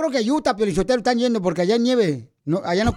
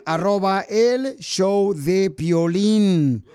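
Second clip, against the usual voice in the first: drawn-out show plug; 3 semitones lower, much slower than usual. Acted. Radio host plugging the show.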